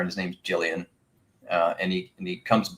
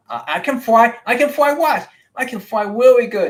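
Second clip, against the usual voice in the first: very high, loud squeaky little owl; a tiny owl boasting that he can fly; tiny owl child voice; 8 dB louder than usual. Acted. GM voicing the little owl bragging to the party, very high.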